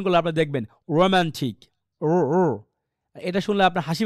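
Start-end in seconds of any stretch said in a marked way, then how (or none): 1.0-1.6 English-style "romantic" for রোমান্টিক. Shown as a demonstration. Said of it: "এটা খুবই রোমান্টিক একটা উচ্চারণ হয়". 2.0-2.4 ও, ও as an untrilled English "r-o" sound, twice; isolated English R. Shown as a demonstration. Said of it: "এরকম একটা উচ্চারণ হয়".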